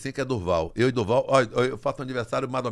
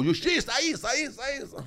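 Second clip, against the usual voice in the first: a fading echo, higher and dying away; mock echo; 10 semitones higher than usual. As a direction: echo the word into silence. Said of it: "A voz chegou a dar eco". (imitando o eco, mais agudo e sumindo)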